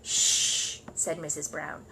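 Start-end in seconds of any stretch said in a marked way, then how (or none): 0.0-0.8 hushing. Acted. Missus Brown hushing him.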